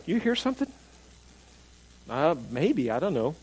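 softly acted puzzled exchange; hushed aside. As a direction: play two listeners quietly checking with each other. Puzzled people murmuring to each other.

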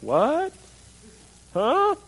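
high, puzzled what-huh; imitating a confused listener. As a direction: play baffled, pitch jumping up. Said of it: "looking at me like".

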